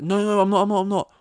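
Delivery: high, loud, hammed-up pleading; frantic protest. Caller accused of a prank call, protesting frantically.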